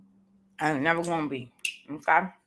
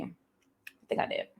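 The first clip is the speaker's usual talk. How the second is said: whispered aside; 9 dB quieter, slower than usual. Real, not acted. Whispered aside while eating.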